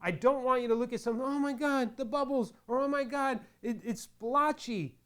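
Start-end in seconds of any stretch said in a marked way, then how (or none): 1.2-4.9 mock panic. Mocking a panicked beginner.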